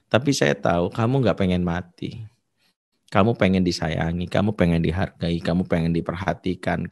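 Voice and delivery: lebih pelan dan rendah, membujuk lembut; menenangkan. Retold or imitated. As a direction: bujuk anak supaya taruh pisau. Ustadz menenangkan anak berpisau. (suara lembut menenangkan anak)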